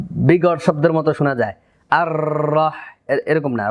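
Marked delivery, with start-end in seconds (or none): 1.9-2.7 "আর রহ" with a long, exaggerated trilled r; over-rolled r. Shown as a demonstration. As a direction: overdo the tongue roll in "আর রহ". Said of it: "এত বেশি তাকরার না". ("আর রহ"-এ র অতিরিক্ত কাঁপিয়ে)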